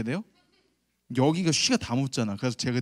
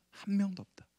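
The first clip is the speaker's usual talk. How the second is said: hushed whisper driving the point home; whispered emphasis; 6 semitones higher, 6 dB quieter than usual. Acted. Preacher whispering to the congregation that no one is righteous. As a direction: lean in and whisper it.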